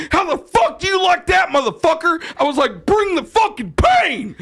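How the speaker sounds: high shouted trash talk; taunting his eleven-year-old son after finally winning, shouting; gloating shout. Retold or imitated. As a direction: yell it in his face, gloating.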